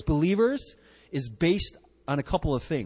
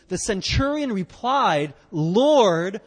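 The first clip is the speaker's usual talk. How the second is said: reading scripture together; 3 semitones higher, 4 dB louder than usual. Shown as a demonstration. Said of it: "let's read verse eight together".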